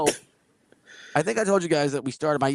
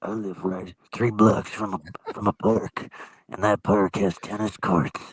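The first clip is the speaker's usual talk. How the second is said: lower, slow mock of the strange guy's musing; odd drifter voice; 7 semitones lower, slower than usual. Man imitates the tennis drifter asking why people say shoot. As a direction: play the creepy stranger puzzling over a word.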